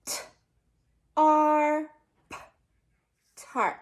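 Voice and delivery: slow letter-by-letter spelling of "tarp"; teacher sounding out tarp; sounding out a word. Shown as a demonstration. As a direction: spell "tarp" as T, R, P, then say it whole. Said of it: "Do it one more time".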